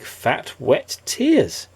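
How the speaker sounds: plain reading voice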